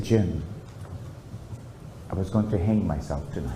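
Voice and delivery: low, quiet, heavy confession; low, hushed confession to a friend; friend's confession. Retold or imitated. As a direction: softly admit the unbearable. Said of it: "He said".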